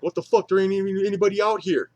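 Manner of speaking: loud, high angry shout; angry yell. Retold or imitated. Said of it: "he's, like, all mad".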